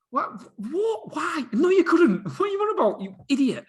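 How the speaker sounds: high-pitched, hammed-up disbelief; incredulous self-scolding. Argues with his own cocky thought, high mock outrage. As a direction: squeal it at yourself, loud in the middle.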